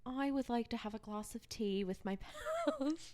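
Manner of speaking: British accent breaking into giggles; soft, shaky-with-laughter British attempt. Shown as a demonstration. Friend asked to show off for hosts, bad British accent. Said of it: "do your British accent really quick".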